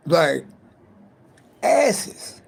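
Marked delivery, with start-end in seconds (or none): 1.6-2.4 excited. Real, not acted. Raving about loving asses.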